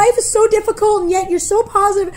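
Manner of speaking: high tearful build to a loud wail; hammed-up crying; mock wailing. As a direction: imitate someone bawling, peaking on the last words. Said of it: "Ah, crying".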